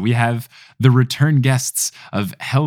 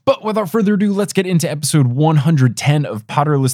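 bright announcer intro, pitched up; podcast intro announce; 7 semitones higher, 3 dB louder than usual. Acted. Host introducing episode and guests, announcer voice.

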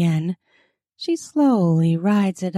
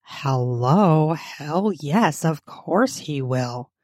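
put-on lower, somewhat husky girl's voice; low husky character voice; huskier voice; 4 semitones lower, slower than usual. A narrator's reading. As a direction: drop the pitch, a little husky. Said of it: "in a somewhat huskier voice".

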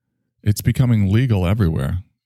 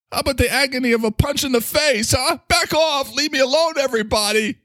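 high, strained voice snapping back off; fed-up loser yelling back. Acted. Ski-lift loser yelling back at the crowd in a high voice. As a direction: shriek at the crowd to leave him be.

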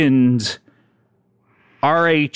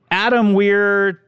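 mock show intro; 4 semitones higher than usual. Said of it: "we're back".

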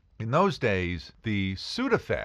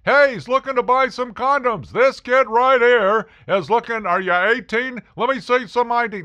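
much louder, higher mocking announcement; broadcasting pharmacist; 13 semitones higher, 8 dB louder than usual. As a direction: announce it to the whole store. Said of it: "he didn't broadcast it like that".

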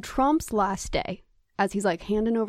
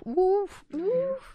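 playful woof; 10 semitones higher, 4 dB quieter than usual. Pretend. Playful bark.

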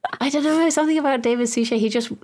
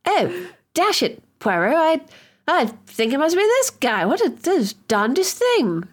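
high, slow bumbling Englishman; mock posh Hastings bluster; Hastings impression; 8 semitones higher than usual. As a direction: ham up a flustered upper-class sidekick. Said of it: "He'll always be like".

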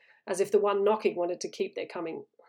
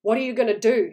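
usual speaking voice

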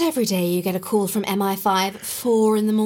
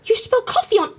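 loud, higher, fast furious telling-off; outraged scolding; 6 semitones higher, 5 dB louder than usual. Acted. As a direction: scold him for ruining the treasure. Coffee spilled on ancient papyrus.

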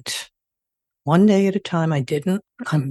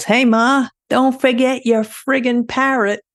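yelling; 8 semitones higher than usual. Retold or imitated. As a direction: yell down at Ma, taunting. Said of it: "And he yells".

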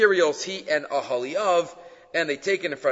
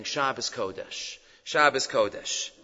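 soft, slow, compassionate murmur; imitated tearful tenderness; 4 semitones lower, 5 dB quieter than usual. Chafetz Chaim looking the boy in the eye, tenderly tearful. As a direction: say it gently, as if weeping with love. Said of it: "He just cried".

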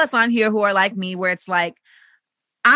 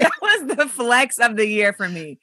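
ordinary talk, nothing marked

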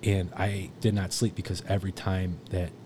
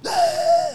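very high, loud imitated yell from next door; imitated party scream; 8 dB louder than usual. Teller imitating partiers screaming next door, high loud yell. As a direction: mimic the rowdy scream through the wall.

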